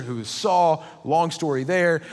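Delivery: usual speaking voice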